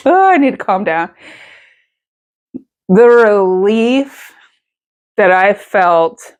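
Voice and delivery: fighting back tears, slowed on "The relief"; getting emotional. Real, not acted. Telling viewers about waking after surgery, near tears. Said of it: "I'm gonna get emotional".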